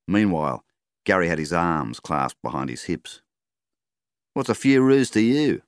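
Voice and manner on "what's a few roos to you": higher and louder, challenging; shooter's voice. A narrator's reading. Roo shooter challenging the farmer, higher louder. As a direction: throw the question back.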